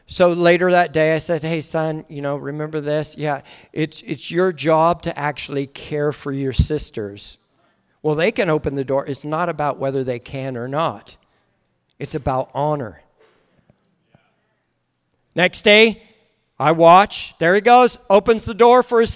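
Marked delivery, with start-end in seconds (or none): 1.4-13.0 low, soft, restrained counsel; quiet fatherly correction. Retold or imitated. Father teaching his son about honor, quietly correcting. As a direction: take the child aside and speak softly.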